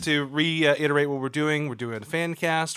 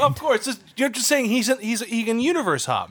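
excited; 9 semitones higher than usual. Real, not acted. Laughing excited defense of pick.

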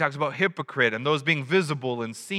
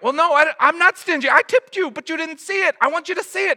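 higher, louder, defensively whiny; mock indignant George; 11 semitones higher, 5 dB louder than usual. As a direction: insist you tipped, offended. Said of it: "this idea of like".

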